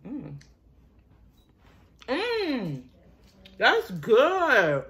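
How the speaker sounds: chewing while murmuring; talking around a bite of muffin; mouth full. Real, not acted. Seen 1: Speaker bites muffin at 0.4, chews with full mouth through 3.4.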